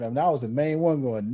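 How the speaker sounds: ordinary talk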